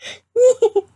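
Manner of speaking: loud squealing laugh; high laugh burst. Real, not acted. Cracking up after quoting mom.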